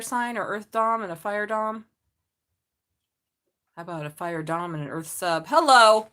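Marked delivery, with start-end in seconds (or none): 5.5-6.1 a sudden loud, cheeky hello; cheeky shouted greeting. Real, not acted. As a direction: call it out, teasing. Flirty exclamation at sex joke.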